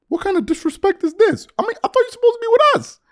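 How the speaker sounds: very high-pitched mock outrage; indignant Laker fan. Voices indignant Laker fans.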